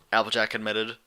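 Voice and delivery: ordinary delivery, nothing marked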